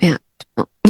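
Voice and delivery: soft, low मैं आत्मा हूँ, held inward; meditative. Shown as a demonstration. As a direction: breathe it out like a meditation. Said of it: "one to fifty time count करो".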